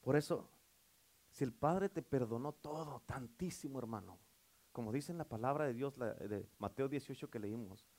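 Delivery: soft, low, intimate preaching; hushed sermon close. Acted. Preacher softly urges the congregation to forgive, hushed and intimate.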